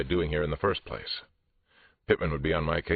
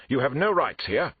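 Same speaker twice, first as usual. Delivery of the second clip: loud, high-pitched outrage; indignant protest; 11 semitones higher, 5 dB louder than usual. Acted. Suspect telling police they have no rights, indignant. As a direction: lay down the law indignantly.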